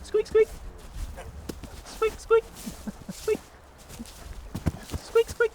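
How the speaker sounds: high squeaky rat noise; squeaky rat squeak. Shown as a demonstration. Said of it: "be like".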